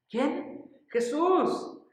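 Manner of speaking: high, loud cry of the name; exclaimed. Acted. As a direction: call out the name, ringing. Preacher exclaims the name Jesus.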